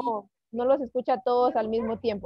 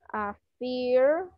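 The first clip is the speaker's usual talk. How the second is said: slow, drawn-out Affirmative; slow teaching model; much slower than usual. Shown as a demonstration. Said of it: "tenemos una afirmativa". (pronunciación lenta y modelada)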